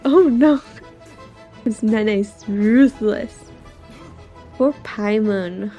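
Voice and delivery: ordinary delivery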